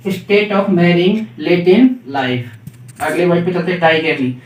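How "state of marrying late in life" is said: slow dictation. Shown as a demonstration. Teacher dictating a definition.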